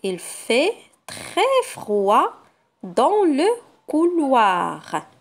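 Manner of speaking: put-on slow reading for children; slow sentence reading. Shown as a demonstration. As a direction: read slowly and clearly. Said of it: "Je passe à la deuxième phrase".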